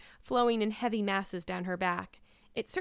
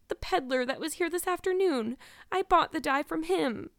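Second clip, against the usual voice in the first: put-on higher-pitched, earnest girlish explanation; confessing girl; 8 semitones higher, 3 dB louder than usual. A narrator's reading. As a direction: explain yourself to your guardian.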